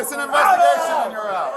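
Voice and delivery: high shouted order; shouting. Real, not acted. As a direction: cut them off sharply. Officer ordering people out.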